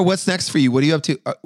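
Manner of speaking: usual speaking voice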